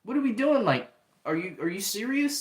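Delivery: high, baffled mock disbelief; lightly acted stunned teen; incredulous Peter. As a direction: play it like you can't believe what he's doing. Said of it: "He was kinda, like, looking at".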